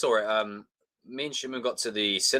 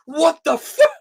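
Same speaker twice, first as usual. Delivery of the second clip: very high, loud disbelief; shocked shout; 10 dB louder, slower than usual. Acted. Viewer reacting to a name reveal, shouting. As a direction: yell it in mock shock.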